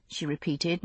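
ordinary delivery, nothing marked

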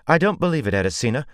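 high, loud angry snap; loud furious retort; snapped. A narrator's reading. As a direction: cut her off in outrage. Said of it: "Jolene snapped".

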